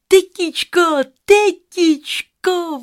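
high, loud drawn-out call; excited girl shouting ahead for her aunt. A narrator's reading. Girl runs home to her auntie, calling out. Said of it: "volala, když přiběhla k houseboatu".

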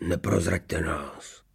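slow, soft, secretive plea; pleading whisper. A narrator's reading. Fugitive begs boys not to betray him, whispering. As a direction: beg them in a drawn-out whisper. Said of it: "zašeptal prosebně recidivista Fousek".

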